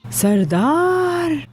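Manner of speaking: name drawn out very slowly, pitched high; exasperated call. Acted. Grandmother appeals to Sardar about the girl's talk, exasperated drawn-out call. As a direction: call his name in a long, high complaint. Calling Sardar to complain of girl.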